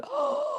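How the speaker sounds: high, drawn-in gasp of shock; mock horrified gasp. Host replaying her shock at what he said, high gasp. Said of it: "I'm horrified sometimes and shocked".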